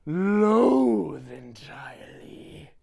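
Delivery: slow hammed-up whisper; mock whisper. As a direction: breathe it out slowly with venom. Grinch reading hate list.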